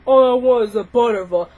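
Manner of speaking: mocking whiny boy. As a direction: ham up the boy begging for his roll. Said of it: "How about that".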